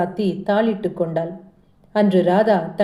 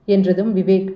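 ordinary delivery, nothing marked

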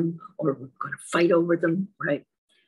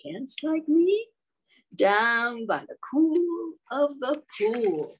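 high, slow, gleeful frog call; high playful frog voice; frog cheering; 6 semitones higher, slower than usual. Acted. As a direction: sing it out like a happy frog. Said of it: "Frog cried".

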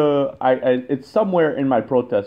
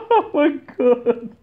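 laughing apology; 4 semitones higher than usual. Real, not acted. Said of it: "I'm not laughing at you".

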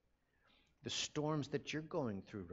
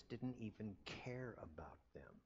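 hushed whisper; 9 dB quieter than usual. Retold or imitated. Preacher voicing disciples' doubt.

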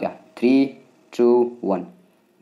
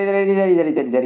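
urgent high-pitched "इधर, इधर"; excited calling out; 9 semitones higher, slower than usual. Real, not acted. Urgently pointing out the route. ("इधर" ऊँची, तेज़ आवाज़ में पुकारना)

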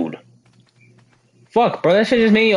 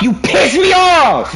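angry shout; 8 semitones higher, 3 dB louder than usual. Real, not acted. Repeated angry line.

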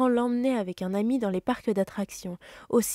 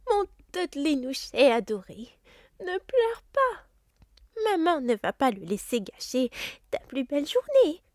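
doting cry; 7 semitones higher, 3 dB louder than usual. Acted. Mother Petunia hugging her crying son, high and cooing. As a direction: coo over a spoiled child. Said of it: "s'écria madame Dursley".

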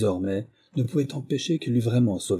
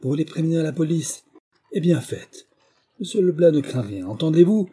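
higher, forceful indignant defiance; angry, emotional retort; 6 semitones higher, 4 dB louder than usual. A narrator's reading. Woman defying threat of the police, angry and emotional. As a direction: answer back hotly, climbing to a louder challenge. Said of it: "voix que l'émotion et la colère faisaient trembler".

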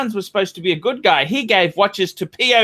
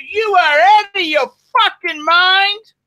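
outraged; 9 semitones higher, 4 dB louder than usual. Real, not acted. Host berating Clive's friends, high and outraged. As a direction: climb in pitch with disbelief.

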